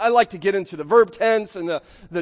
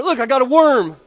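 put-on excited kid; 5 semitones higher, 8 dB louder than usual. Kid proudly showing dad a worm, excited. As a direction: look and worm pushed up loud and high. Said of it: "they're just all excited".

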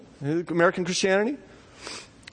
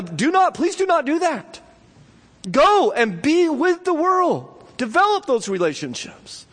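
pleading higher and higher, slowing at the end; urgent high plea; 9 semitones higher than usual. Real, not acted. Preacher urging his church to leave the building, high urgent plea. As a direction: beg the congregation to go out. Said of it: "So just hear me very clearly".

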